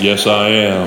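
low cowboy movie-star drawl; John Wayne. Retold or imitated. Golfer answering his awestruck partner, mock cowboy swagger. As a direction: drop it low and swagger through it. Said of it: "in my best John Wayne voice".

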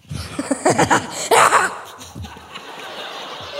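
high-pitched mad scientist cackle; long, breathless villainous laugh; evil laugh. Acted. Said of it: "Like a mad scientist".

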